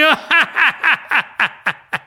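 high loud villainous cackle; evil laugh. Acted. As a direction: laugh in triumph. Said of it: "Dussasana laughed".

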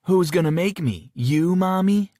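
high, loud mocking taunt; mocking challenge. A narrator's reading. Brother mocking his sister as Mommy, taunting. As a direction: taunt your bossy sister. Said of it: "Jerry questioned".

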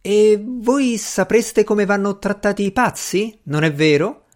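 high, condescending question; scornful. A narrator's reading. Doctor doubting Strange can treat madmen, scornful. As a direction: needle him with lofty doubt. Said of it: "con un risolino sprezzante".

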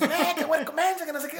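a mother scolding her kid after he nearly drowned, high and mocking.

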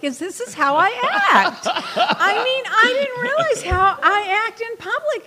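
laughing through the words, high-pitched; giggling at her own antics. Real, not acted.

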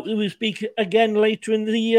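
ordinary delivery

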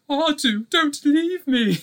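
high, slow, pleading droid voice; C-3PO whimper. Pretend. Host pictures himself as the cowering droid, high pleading voice. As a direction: beg like a cowering golden droid. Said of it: "the one going".

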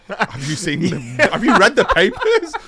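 laughing high and loud through the words; cracking up while asking; giggly high-pitched question. Real, not acted. Laughing at shock over reputation.

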